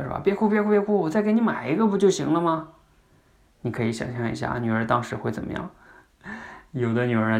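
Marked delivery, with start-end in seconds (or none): 0.2-2.7 higher, louder, hurried pleading to stop crying; flustered dad. Retold or imitated. Dad, more anxious, begging his crying daughter to stop, higher and louder. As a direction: rush to hush a crying child, voice climbing. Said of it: "就更，更着急了".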